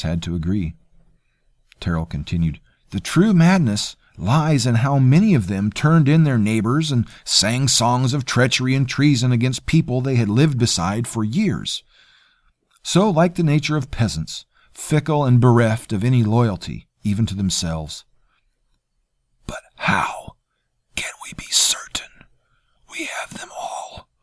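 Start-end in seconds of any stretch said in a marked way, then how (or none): 2.9-18.0 lightly voiced oily courtier, higher; a hint of a smug, polite cousin; smooth courtier. Terrell scornful of peasants. 19.5-24.1 hoarse, pained whisper. A narrator's reading. Janus pleading in whisper.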